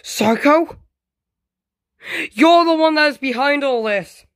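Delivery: high-pitched, shocked confrontation; high, play-acted dismay; alarmed accusation. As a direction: accuse the villain in rising alarm. Victim confronts the villain.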